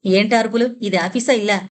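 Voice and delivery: ordinary delivery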